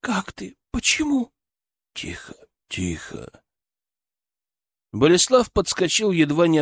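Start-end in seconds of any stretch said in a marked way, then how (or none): 0.0-1.3 put-on weak girl voice. 1.9-3.4 soothing hush. Acted. Father hushes daughter.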